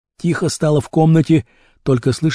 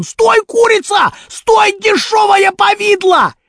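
very high, slow yelled insults; abusive shouting; 3 dB louder, slower than usual. A narrator's reading. Rashpil yells after fleeing Kurochkin, furious shouting. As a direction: bawl after the runaway, dragging each insult. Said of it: "кричал он".

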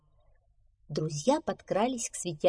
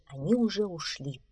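plain reading voice

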